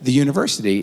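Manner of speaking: mock casual question; fake casual probe. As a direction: sound offhand while digging. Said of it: "So I say".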